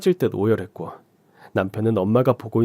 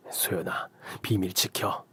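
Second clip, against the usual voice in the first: softer unvoiced whisper to the daughter; whispered warning; 3 semitones lower, 9 dB quieter, slower than usual. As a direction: hush her, keep it secret.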